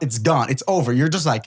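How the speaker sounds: ordinary delivery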